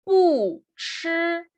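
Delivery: "bu" falling from high, "shi" held high and level; original fourth tone on bu. Shown as a demonstration. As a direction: drop "bu" hard, then sustain "shi" high. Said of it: "mantiene su tono original".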